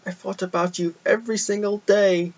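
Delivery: put-on a gentle bear telling his old friend he missed him; Pooh voice. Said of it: "and Pooh's like".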